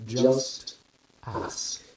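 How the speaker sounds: Just, pause, then ask whispered; slow careful enunciation. A narrator's reading. Scientist taunting the lieutenant, slow careful enunciation. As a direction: spell it out word by word. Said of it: "speaks slowly, enunciating carefully".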